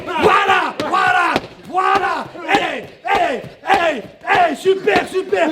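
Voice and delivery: high, drawn-out cheering calls; shouted encouragement. Real, not acted. Coach cheering fighter through the drill, shouted encouragement. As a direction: cheer him through each hit.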